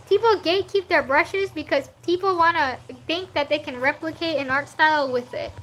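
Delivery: usual speaking voice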